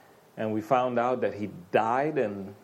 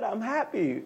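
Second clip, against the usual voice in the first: higher, bright pretend cheer; a hammed-up little "I'm happy"; mock happy voice; 6 semitones higher than usual. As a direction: play the carefree happy guy.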